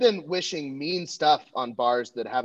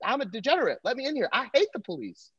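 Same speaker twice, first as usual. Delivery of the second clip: high pleading at the door; mock plea; 9 semitones higher than usual. Comic pleading with bar staff, high pitched. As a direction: beg to be let in, raised. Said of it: "I'm like".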